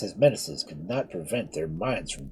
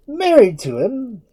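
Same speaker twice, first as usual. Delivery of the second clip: high, loud, slow cry of disbelief; anguished cry; 11 dB louder, slower than usual. Acted. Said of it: "cried Edmund".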